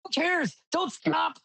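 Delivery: very high-pitched frantic mock yell; urgent, high-pitched retort; acted yelling back. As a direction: play a co-driver hollering back over noise. Acted co-driver yelling back mid-race.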